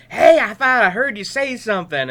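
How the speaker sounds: put-on child at parents' bedside at night; eager kid. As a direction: pester the parents cheerfully.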